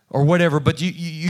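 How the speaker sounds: ordinary talk, nothing marked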